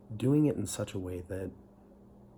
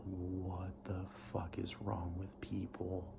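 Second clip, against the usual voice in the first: much softer, slow whispered aside; whispered disbelief; 8 dB quieter, slower than usual. Retold or imitated. New missionary thinking about a pushy member, whispered disbelief. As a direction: breathe it out in stunned disbelief.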